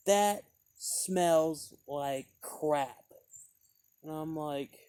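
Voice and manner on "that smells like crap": very slow, louder disdainful drawl; imitating the rude woman's verdict; rude dismissal. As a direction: sneer it out slowly. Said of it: "saying the name of it".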